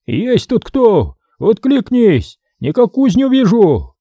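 put-on high, drawn-out elderly hail; old man calling. A narrator's reading. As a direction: call out slowly into the dark as an old man. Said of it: "Старческий голос, прерываясь кряхтением и кашлем, окликнул".

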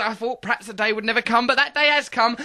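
mock-solemn build to the guest's name; hammed-up ceremonial introduction; grand announcement.

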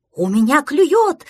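loud, slow, high excited yell. A narrator's reading. Prostodursen fishing in pretend river, yelling. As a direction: holler it out, stretching the words. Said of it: "завопил Простодурсон".

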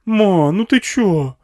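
put-on high, loud, drawn-out kid's whine; protesting boy. A narrator's reading. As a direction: object to mum in disbelief. Boy protests at his mother.

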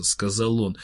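usual speaking voice

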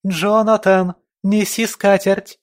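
put-on high, loud child's yell. A narrator's reading. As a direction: shout across the house at the top of her lungs. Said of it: "закричала, что есть мочи". (крик во весь голос)

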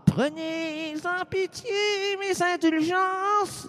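high, slow pleading prayer voice; mock plea. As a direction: beg heaven in a high, drawn-out whine. Prière moqueuse au ciel. (supplication aiguë et traînante, pour rire)

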